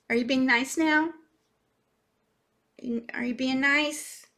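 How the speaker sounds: high-pitched coaxing of a pet; pet talk. Real, not acted. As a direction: ask your pet sweetly, voice up high. Baby-talk to pet Gracie.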